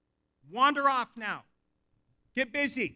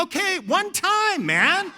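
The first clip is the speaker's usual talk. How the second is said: high, slow comic concession; mock-resigned outburst; exasperated surrender; 9 semitones higher, 5 dB louder, slower than usual. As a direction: give in reluctantly, drawn out and pitched up. Comic giving in to misread request.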